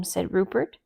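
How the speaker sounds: ordinary delivery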